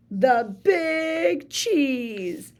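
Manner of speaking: the Big Cheese stretched out slowly, each word weighty; slow grand announcement. Acted. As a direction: draw it out like a title. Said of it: "say it with me".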